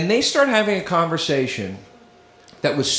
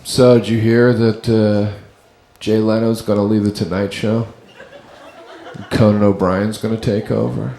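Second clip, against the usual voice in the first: low dopey mock voice of a chatty kid; 7 semitones lower than usual. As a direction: imitate the kid, lower and dopey. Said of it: "The first kid goes".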